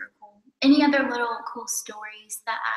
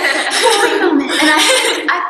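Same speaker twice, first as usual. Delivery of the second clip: louder, slower, with a laugh; laughing holy moment; 5 semitones higher, 11 dB louder, slower than usual. Real, not acted. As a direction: correct yourself, laughing out loud. Correcting herself, laughing.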